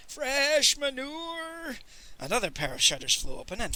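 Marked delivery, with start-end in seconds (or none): 0.1-1.8 high, long-drawn mournful hawker's call, softened; street cry. A narrator's reading. Peasant hawking manure in the street, crying out. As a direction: sing it out high and sorrowfully. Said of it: "cried the peasant".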